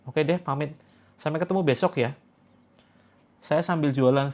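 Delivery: ordinary talk, nothing marked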